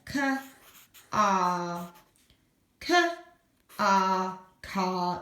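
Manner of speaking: K and R said apart, then blended into car; slow phoneme segmenting of car; sounding out car. Shown as a demonstration. Teacher models blending car for the children. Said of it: "sounding out car".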